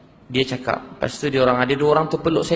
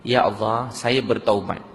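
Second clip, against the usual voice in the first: slow, lower, measured prayer; leading a repentance prayer; 4 semitones lower, slower than usual. Retold or imitated. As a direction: lead them phrase by phrase, slowly. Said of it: "cuba ikut saya".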